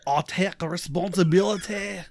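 wobbly put-on Scottish accent; half-Scottish fake accent; slightly Scottish accent. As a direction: attempt an accent that drifts into Scottish. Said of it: "that was like Scottish".

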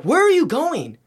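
high, loud, incredulous call; calling after him. Retold or imitated. Calling to a brother walking away, incredulous.

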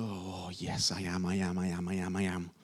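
soft, low affirmation to the mirror. Retold or imitated. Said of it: "just go".

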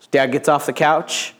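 normal talking voice

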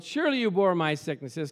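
normal talking voice

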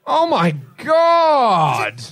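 drawn-out shout. Acted. Read-out scene, drawn-out cry.

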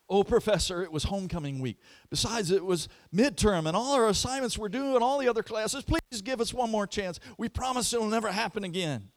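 high, pleading student voices, hurried; begging students. Retold or imitated. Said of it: "they were contrite".

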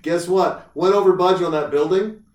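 hammed-up preacher proclaiming a surprise; imagined preacher. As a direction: announce good news like a preacher to the congregation. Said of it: "there's never been a preacher that's like".

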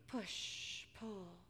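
hushed, rhythmic rowing chant; very soft storybook hush; quiet rowing. A narrator's reading. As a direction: barely voice each stroke, with pauses. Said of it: "Quietly, the disciples rowed".

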